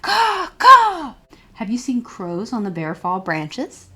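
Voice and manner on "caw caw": high, loud cawing like a crow. Acted. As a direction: caw out like a noisy crow. Imitating crows before crow page.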